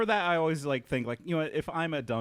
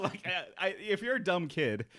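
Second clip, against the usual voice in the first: ordinary talk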